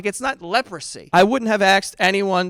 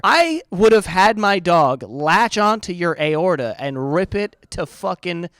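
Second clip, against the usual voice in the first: ordinary delivery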